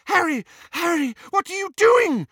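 high-pitched, frantic shout; alarmed cry. A narrator's reading. Hermione to Harry as he runs at the dragon, alarmed cry. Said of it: "cried Hermione".

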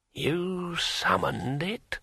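put-on an old dead man querying word by word; drawn-out, rising old-man question; brittle old voice. A narrator's reading. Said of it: "in a brittle old voice".

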